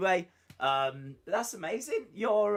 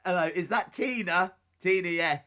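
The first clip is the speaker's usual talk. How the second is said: mock phone call, higher; 6 semitones higher, 6 dB louder than usual. Acts a second phone call.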